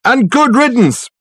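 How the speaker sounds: high, loud, drawn-out yell; shouted. A narrator's reading. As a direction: holler it slowly after someone leaving. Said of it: "shouted a voice from the inner office".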